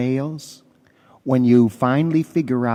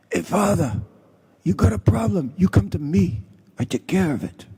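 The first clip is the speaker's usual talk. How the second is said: higher, Sicilian-American mobster voice; mafia don impression; 8 semitones higher than usual. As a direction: play the friendly godfather offering favors. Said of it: "That's how he talked".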